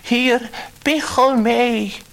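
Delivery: high, loud slow call across the sea; sing-song loud calling, slow. A narrator's reading. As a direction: call out to the sea, hands cupped round the mouth. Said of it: "zette hij zijn handen voor zijn mond en riep".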